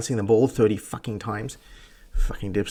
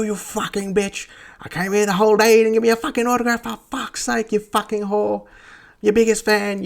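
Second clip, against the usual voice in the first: high-pitched mock heckling from afar; imitated yelling; 10 semitones higher than usual. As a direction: rant like an outraged, jilted fan. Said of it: "my mate just yells".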